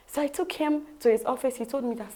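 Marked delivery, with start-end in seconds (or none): none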